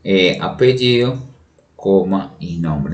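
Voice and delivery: slow, deliberate dictation of punctuation. Shown as a demonstration. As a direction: read it out slowly so students can type along. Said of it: "y si son dos autores".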